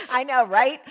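high laugh through the words. Real, not acted. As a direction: laugh while answering. Laughing along.

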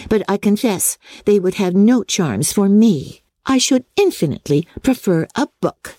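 a hint of a prim lecturing sister; grave Mary. A narrator's reading. Mary gravely replying to Lydia. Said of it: "Mary very gravely replied".

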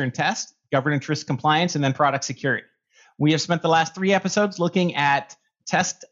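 ordinary talk, nothing marked

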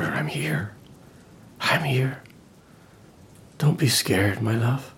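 a gentle hushed reassurance; tender whisper. Retold or imitated. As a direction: soothe her in the dark. Imagined comforting whisper.

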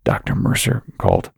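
faint, breathy, barely voiced; thin weak voice. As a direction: fake weakness, almost a whisper. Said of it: "in a thin, weak voice".

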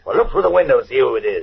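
urgent and impatient; impatient order. Acted. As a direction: snap out the order loudly and fast. Orders panicking partner to window.

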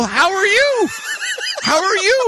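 very high, loud mock enthusiasm; hammed-up starstruck greeting; overexcited shouting. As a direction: shout it giddily, way up in pitch. Starstruck reply in retold story.

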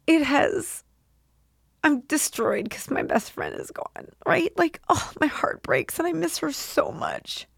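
high, breaking voice of grief; getting emotional. Real, not acted. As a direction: fight back tears for a lost best friend. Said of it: "Oh my God, I get emotional".

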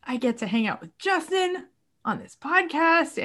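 holding back real tears; moved to tears. Real, not acted. Telling the host how lucky she feels, on the edge of tears. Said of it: "sorry, I'm getting moved to tears".

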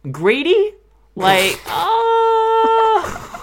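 shrieking high disbelief, loud; incredulous outburst. Real, not acted. Podcaster reacting in disbelief to being called greedy, shrieked high. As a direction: squeal the word in outrage with a laugh.

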